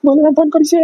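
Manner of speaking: high, strained cry of the name; forcing it out in fear; frightened strained voice. Acted. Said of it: "এমনিতে আপনি কথা বলতে পারছেন না". (ভয়ে উঁচু, চাপা গলায় বলা)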